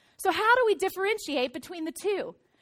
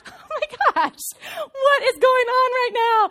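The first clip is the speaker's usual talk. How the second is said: high, loud, laughing disbelief; acting out helpless laughter at a child's drama; laughing hard; 6 semitones higher, 5 dB louder than usual. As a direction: exclaim it through mock stifled laughter. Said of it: "I'm laughing so hard".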